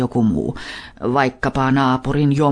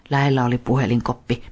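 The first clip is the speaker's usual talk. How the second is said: ordinary delivery, nothing marked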